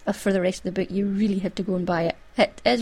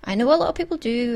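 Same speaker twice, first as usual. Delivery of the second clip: usual speaking voice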